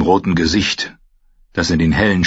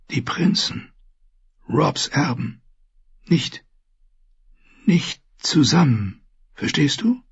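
soft, strained, halting; weak dying maester; 7 semitones higher, 3 dB quieter than usual. Acted. Dying maester instructs Osha, soft and strained. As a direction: push the words out with effort.

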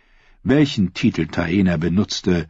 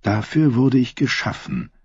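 self-satisfied private remark, slightly raised pitch; to herself; 5 semitones higher than usual. A narrator's reading. Queen alone after her seduction, musing to herself. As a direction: say it to yourself, pleased. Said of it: "sagte sie zu sich".

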